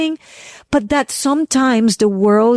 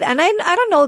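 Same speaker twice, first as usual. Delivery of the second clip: ordinary talk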